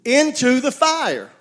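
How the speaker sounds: high, loud exhortation, slowing at the end; preaching cry. Real, not acted. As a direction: call it out to the church.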